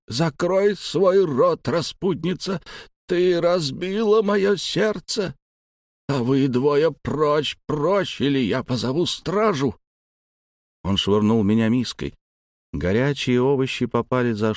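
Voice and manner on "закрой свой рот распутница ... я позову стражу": much higher, slow, heartbroken fury; raging scream. Acted. The old man rages at his granddaughter, much higher and slow. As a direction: scream at the girl who broke his heart.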